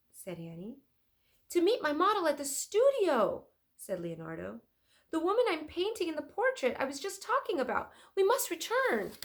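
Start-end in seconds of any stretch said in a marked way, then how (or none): 1.5-9.1 higher, louder rushed urgency; hurried Leonardo. Acted. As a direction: rush everyone back.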